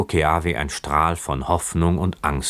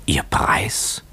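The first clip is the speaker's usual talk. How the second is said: stunned whisper; 2 semitones higher than usual. A narrator's reading. Stunned young man repeats question.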